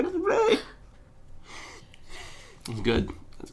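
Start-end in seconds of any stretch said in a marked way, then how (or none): 0.0-0.6 very high mock "explain"; meme girl voice. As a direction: squeak the demand. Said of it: "When she go".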